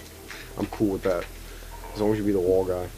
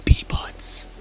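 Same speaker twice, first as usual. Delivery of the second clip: whisper. Acted. Whispered nonsense.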